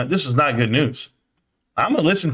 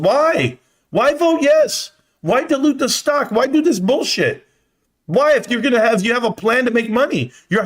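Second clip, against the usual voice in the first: demanding answers, voice pitched up; outraged rant; 7 semitones higher than usual. Real, not acted. Streamer ranting at viewers about the vote, outraged.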